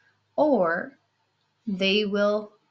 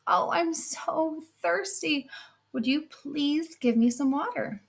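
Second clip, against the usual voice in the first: put-on old crone; 6 semitones higher than usual. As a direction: beg for water like a frail hag. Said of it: "she hobbles over to her, and she goes".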